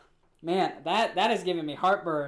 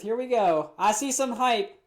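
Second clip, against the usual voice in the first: usual speaking voice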